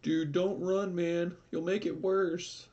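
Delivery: very high, wimpy pleading imitation; mock a scared buddy in a falsetto whine; mocked cowardly friend. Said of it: "is a little bitch, basically".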